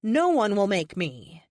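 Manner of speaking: ordinary delivery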